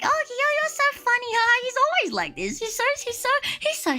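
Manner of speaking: high, sweet auntie voice; imitating the boss. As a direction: laugh off his rudeness in a high giggly voice. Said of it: "She was like".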